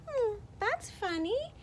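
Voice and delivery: high then slow, drawn-out puzzled voice; puzzled character. Acted. Teacher voicing rabbit-seer, puzzled and deliberate. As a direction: muse over it slowly. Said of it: "And this person says".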